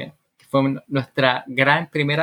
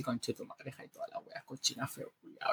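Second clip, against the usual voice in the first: very soft muttered swearing; cursing under the breath; 21 dB quieter than usual. Acted. Wife left with the dirty dishes, muttered cursing. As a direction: mutter resentfully, barely audible.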